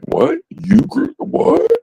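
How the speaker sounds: mock a friend who can't believe you grew it; imitating amazed buddy. Friend at his house reacting to homegrown weed, hammed-up disbelief.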